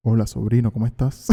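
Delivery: a hint of a smooth flirt; seductive voice. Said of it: "Lanza tu voz".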